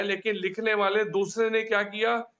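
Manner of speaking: ordinary talk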